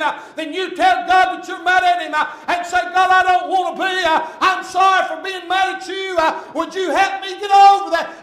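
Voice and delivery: loud, high, shouting preacher; full-force revival preaching; fervent preaching. Real, not acted. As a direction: drive it home from the pulpit.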